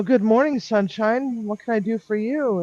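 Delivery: bright, high sing-song hello; cheery greeting. Retold or imitated. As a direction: greet her like sunshine itself.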